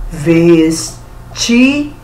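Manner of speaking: "Vestido" drawn out in three separate syllables; slow, syllable by syllable. Shown as a demonstration. Teacher showing pupils how to count syllables, slowly syllable by syllable. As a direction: break "Vestido" into ves, ti, do, very slowly. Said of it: "Você pronunciar a palavra devagarinho".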